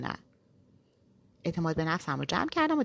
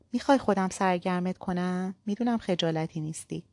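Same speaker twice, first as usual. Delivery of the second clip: usual speaking voice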